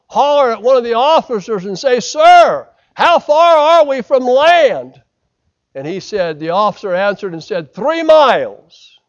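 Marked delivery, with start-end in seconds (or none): 2.0-5.0 high, loud, slow call out; shouting across water. Retold or imitated. Sailor hollering in the dark. 7.7-9.0 called answer. Retold or imitated. Officer calling back.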